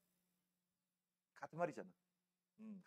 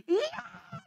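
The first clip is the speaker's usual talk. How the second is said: imitated scream; much slower than usual. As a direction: shriek like schoolgirls in shock. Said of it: "소리 지르더니 막 울어". (여학생들의 높고 큰 비명 흉내)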